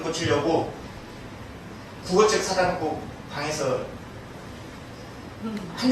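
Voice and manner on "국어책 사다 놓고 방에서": normal talking voice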